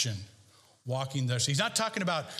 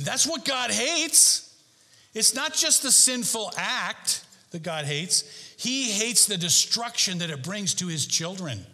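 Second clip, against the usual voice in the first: preach; 5 semitones higher than usual. Acted. Preaching at full force.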